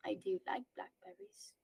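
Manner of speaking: soft hushed whisper. Real, not acted. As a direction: breathe it in a whisper. Unvoiced whisper confession.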